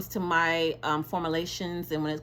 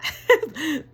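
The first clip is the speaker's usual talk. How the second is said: high laugh at herself; 11 semitones higher, 9 dB louder than usual. Real, not acted. Laughing at own slip.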